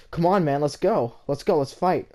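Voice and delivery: imitating a kid loudly goading a fight; taunting shout. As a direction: egg him on to fight. Rob challenges narrator to fight.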